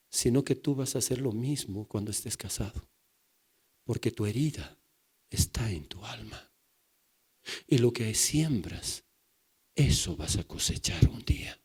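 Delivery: drops to a soft, hushed whisper; hushed warning. Real, not acted. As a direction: lean in and breathe the warning.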